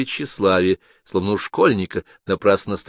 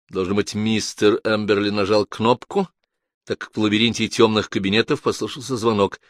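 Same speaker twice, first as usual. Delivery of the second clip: usual speaking voice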